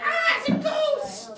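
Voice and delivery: high, loud mock scream of fright; scared disciples. Teacher acting disciples spotting a ghost, mock fright. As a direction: shriek like you've seen a ghost. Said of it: "they're like".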